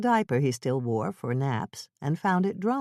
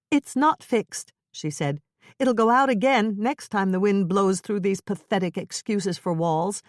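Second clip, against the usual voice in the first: angry; 5 semitones higher than usual. Acted. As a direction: snap it back at him. Wife snapping back in quarrel.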